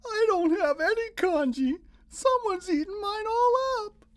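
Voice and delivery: high, loud crying wail; little boy wailing over his empty bowl; wailing child. A narrator's reading. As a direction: wail like a little kid who lost his breakfast. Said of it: "Little Chon wailed".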